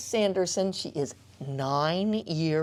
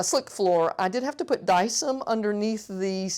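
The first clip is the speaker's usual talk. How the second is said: normal talking voice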